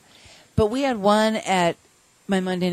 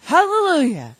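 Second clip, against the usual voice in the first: loud, high proclaiming shout; imitating a drunk man's ecstatic outburst; drunken hallelujah; 8 semitones higher, 7 dB louder than usual. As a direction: belt it out like a drunk who just found religion. Drunk man testifying at meeting.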